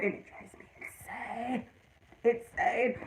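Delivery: drawn-out, hushed exasperation; exasperated. Real, not acted. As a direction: drop to a slow, whispery complaint. Exasperated at husband's brutal honesty.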